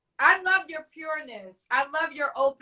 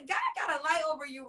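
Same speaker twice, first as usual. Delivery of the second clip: ordinary talk, nothing marked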